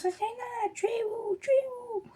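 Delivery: high-pitched imitation of mom calling; mother calling. Vlogger imitating her mom calling to ask where she is. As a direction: squeal it like an impatient mom on the phone. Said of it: "Elle me dit".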